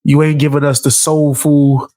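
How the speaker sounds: ordinary talk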